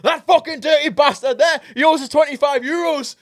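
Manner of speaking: very high, loud comic outrage; accusing yell. Retold or imitated. Pointing out the man to his mates, high indignant yell. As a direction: shout it like a scandalised complainer.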